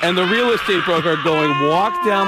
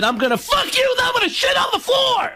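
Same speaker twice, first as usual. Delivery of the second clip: much higher yelled threat; mock shout. Comic retelling the bedroom line, mock shout. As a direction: bellow it crudely as a joke. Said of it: "and I said".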